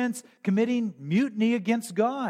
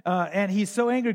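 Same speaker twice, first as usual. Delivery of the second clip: ordinary talk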